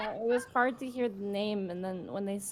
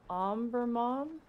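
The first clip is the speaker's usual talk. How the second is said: put-on confused girl voice; 5 semitones higher, slower than usual. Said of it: "looks at you and goes".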